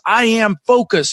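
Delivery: retold mantra, drawn out with a lift; emphatic affirmation. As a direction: declare it slowly, leaning on "I". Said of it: "So it was".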